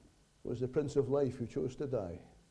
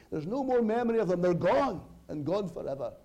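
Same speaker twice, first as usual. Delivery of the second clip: triumphant preaching; 6 semitones higher, 6 dB louder than usual. Real, not acted. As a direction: build to a high, loud pulpit proclamation. Preaches at full force.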